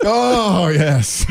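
high, drawn-out "oh", hammed up; mock ecstasy. Co-host relishing the tears of regret, mock ecstatic. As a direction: moan it in pretend bliss.

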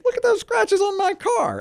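high, indignant retold complaint; woman's complaint, high. Retelling the woman pointing at her car, high and indignant. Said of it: "And she goes".

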